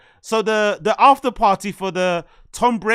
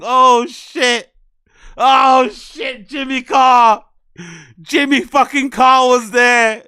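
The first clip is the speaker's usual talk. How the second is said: loud, stunned shouting; shouting in disbelief at the photo; excited shout; 5 dB louder, slower than usual. Real, not acted. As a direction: yell it like you can't believe who's there. Shocked at spotting Jimmy Carr.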